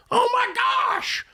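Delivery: a high, drawn-out cry of disbelief at the loan; exasperated shout. Real, not acted. Host reacting to an absurd car loan, with a high slow exasperated shout. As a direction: throw up your hands and yell it high and slow.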